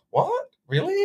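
high, incredulous double take; imitating shocked faces; mock surprise. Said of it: "both of your faces were like".

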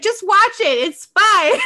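brushing it off. Retold or imitated. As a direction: wave away her worry, laughing it off. Friend brushing off her worry.

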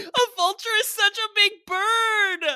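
squealing high through laughter; cracking up over the giant bird; laughing. Real, not acted. Laughing amazement at vulture hat.

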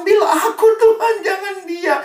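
high sobbing cry to God; crying shout. Retold or imitated. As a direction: wail it out like a desperate mother. Mother begging, crying shout. (teriakan ibu sambil menangis)

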